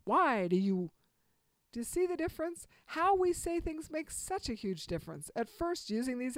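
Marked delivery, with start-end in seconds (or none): 0.0-0.9 a mildly accusing Why do you. Shown as a demonstration. Parent showing the wrong way, mildly accusing. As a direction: let it sound like blame. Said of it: "instead of accusing".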